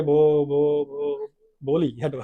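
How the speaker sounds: effortful repeats of "बो"; stammering. Acted. Bholi forcing out her name, stammering. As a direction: stammer on "बो" before the word comes. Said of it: "Would her stammering tongue".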